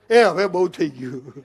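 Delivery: slow, low mock groan of complaint; mock weary grumbling, drawn out; fed-up complaint. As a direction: drag it out, hamming up having had too much. Mimics people fed up with rain.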